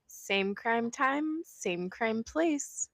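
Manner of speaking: drawn-out podcast outro line; slow sign-off catchphrase. Acted. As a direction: stretch out the closing catchphrase slowly.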